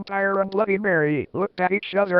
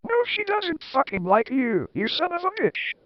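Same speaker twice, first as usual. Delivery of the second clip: mock yell; 8 semitones higher than usual. A narrator's reading. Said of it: "yelled Rocco".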